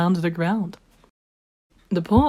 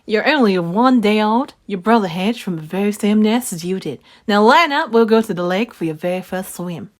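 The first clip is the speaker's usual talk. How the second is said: louder, stern mother duck; 3 semitones higher, 6 dB louder than usual. Acted. Mama duck scolds her ducklings, stern and louder. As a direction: set the ducklings straight. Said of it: "in a stern voice".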